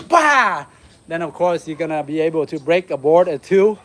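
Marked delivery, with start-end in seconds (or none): 0.1-0.6 loud high shouted bah; kiai shout. Shown as a demonstration. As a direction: bark out the strike sound, loud and high. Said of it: "the hip wind from here".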